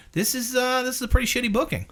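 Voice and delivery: high, fast griping aside; imitating the comic. Comic griping to the crowd about the gig, high mock voice. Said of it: "And he's going".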